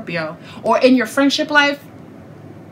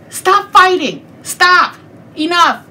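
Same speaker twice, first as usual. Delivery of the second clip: firm stop; 8 semitones higher, 4 dB louder, slower than usual. Real, not acted. Scolding the viewers to stop.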